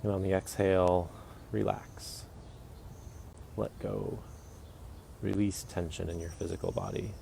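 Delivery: soft, unhurried meditation guide voice; guided meditation. Acted. Guiding listeners through a morning body scan, soft and calm.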